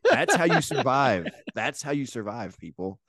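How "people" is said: ordinary talk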